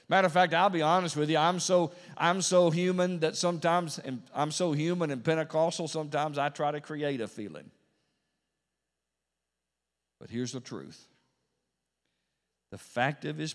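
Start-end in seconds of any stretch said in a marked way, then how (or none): 0.1-7.7 loud, raised pulpit confession; forceful preaching. Real, not acted. Preacher admits being human to the congregation, forceful preaching. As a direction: confess it loudly from the pulpit, pitch lifted.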